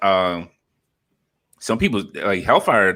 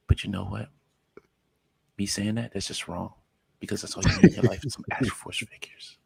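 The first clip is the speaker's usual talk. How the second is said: ASMR whisper; 5 dB quieter than usual. Pretend. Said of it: "I'm just gonna give you my A S M R voice".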